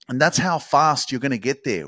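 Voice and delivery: ordinary talk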